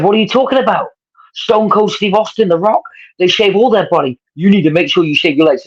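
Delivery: coach voice. Retold or imitated. Coach voiced in retold story.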